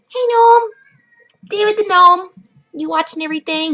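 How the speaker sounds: very high hello called to a little friend; playful calling out. Acted. Greeting the gnome by the front door, high playful call. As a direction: call out high and sing-song, as if to a pet.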